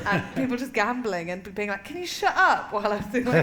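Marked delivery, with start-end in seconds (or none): 1.8-2.7 high, louder mock telling-off; mock indignant complaint. Gambler to comedian mid-set, mock indignant complaint. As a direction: imitate someone irritated by the noise.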